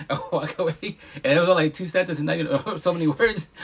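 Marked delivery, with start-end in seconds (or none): none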